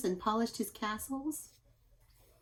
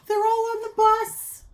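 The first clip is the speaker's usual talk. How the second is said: much higher, louder, thrilled; thrilled to see animals on the bus; excited discovery; 13 semitones higher, 10 dB louder than usual. Shown as a demonstration. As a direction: gasp then burst out with delight.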